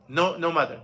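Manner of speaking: ordinary talk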